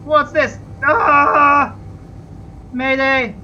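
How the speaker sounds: loud, high panicked cry for help; real panic, calling out for rescue; panicked mayday. Real, not acted. Pilot calls mayday as his ship spins, loud and panicked.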